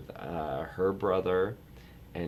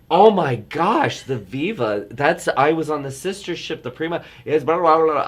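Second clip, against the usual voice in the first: much higher and louder, gushing about the Viva; gushing excitement; 10 semitones higher, 11 dB louder than usual. Retold or imitated. Telling his godmother about his own cruise, giddy and squealing. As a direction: burst into giddy delight. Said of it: "like, came to life".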